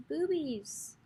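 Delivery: high, soft, drawn-out silly voice; giggly-kid silliness on a drawn-out word; juvenile silliness. Pretend. Vlogger blurting like a seventh grade boy, juvenile silliness. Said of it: "Why am I literally a seventh grade boy?".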